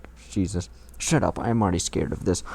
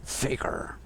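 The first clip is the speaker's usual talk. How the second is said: whisper; 6 dB quieter than usual. Shown as a demonstration. Repeats name, echoing co-host.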